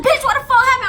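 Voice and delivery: high, loud alarmed friend; alarmed yell. Retold or imitated. Aisha getting off the bus, a high alarmed yell. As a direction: yell it, half laughing.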